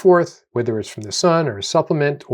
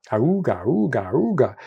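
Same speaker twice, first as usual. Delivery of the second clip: imitating a klaxon going auga; stressed mind's mock siren; mock alarm siren. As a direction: sound off like an alarm horn. Our alarms go off.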